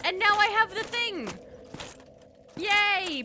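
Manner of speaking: high, loud triumphant cheer; excited cheer. Real, not acted. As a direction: celebrate the win out loud. Finally has the item.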